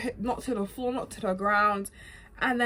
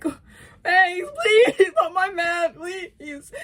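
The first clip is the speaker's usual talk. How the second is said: high, loud mock-crying plea; hammed-up sobbing beg; tearful pleading; 11 semitones higher, 9 dB louder, much slower than usual. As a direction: wail it like a desperate fan. Acted pleading during Joel's beating.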